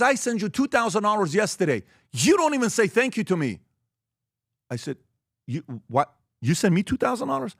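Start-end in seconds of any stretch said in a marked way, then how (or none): 0.0-3.6 higher, aggrieved complaining voice; new finance guy complaining to his boss about no thanks; hurt, higher complaint; upset employee. Retold or imitated. 5.5-7.5 much higher, incredulous question; rising disbelief; stunned disbelief. Retold or imitated. Boss hearing of the payment.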